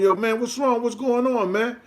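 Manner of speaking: worried asking. Retold or imitated. Pressing friend who won't explain.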